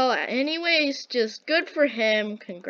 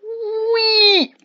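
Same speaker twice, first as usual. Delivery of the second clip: gleeful squeal; 12 semitones higher, 8 dB louder than usual. Real, not acted. Playful cry while flying.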